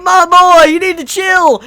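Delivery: high, loud plea to calm down; pleading shout. Retold or imitated. Fan begging Subaru to stop provoking, loud plea. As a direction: yell at your boy to chill.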